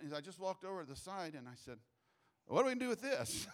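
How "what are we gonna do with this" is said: higher, louder, bewildered ask; startled question. Retold or imitated. Preacher retelling his startled question to the retreat staff, higher and louder. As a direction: throw up your hands at the situation.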